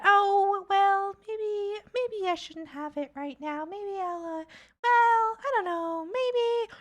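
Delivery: high, dithering mock-unsure voice; hesitant waffling. Mock friend dithering over butter chicken, high and hesitant. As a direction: waver like someone who can't decide. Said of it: "Are you gonna be like".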